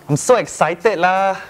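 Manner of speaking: excited Malaysian English with lah; Manglish accent. Shown as a demonstration. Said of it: "When you're going to a theme park".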